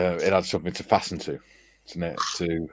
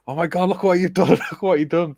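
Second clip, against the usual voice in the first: panicked lads; 10 semitones higher, 4 dB louder than usual. Retold or imitated. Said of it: "And all the lads were like".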